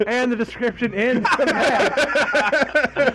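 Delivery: words through laughter; laughing. Real, not acted. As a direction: laugh while talking. Laughing at short review.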